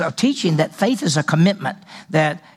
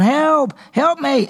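high, drawn-out acted plea; mock cry for help; 8 semitones higher, much slower than usual. Hanging on the side of the mountain, mock cry for help. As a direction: call out for help, pitched well up. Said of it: "and you're going".

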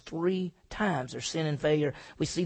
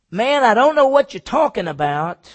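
louder and higher, shouted off; Peter's loud denial; 7 semitones higher, 14 dB louder than usual. Retold or imitated. Preacher repeating Peter's denial to the servant girl, louder. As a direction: bark it back at the crowd.

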